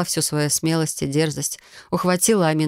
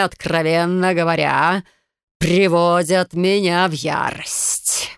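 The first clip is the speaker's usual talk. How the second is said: drawling snake man; slower than usual. Acted. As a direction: draw it out like a stalking predator. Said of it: "протянул".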